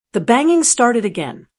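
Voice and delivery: ordinary delivery, nothing marked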